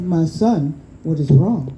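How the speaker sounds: low, loud fatherly creator calling to his son; Baba's deep voice. Acted. Baba comforting his sad son, low and loud. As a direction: boom it down gently like a loving god. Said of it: "And he said".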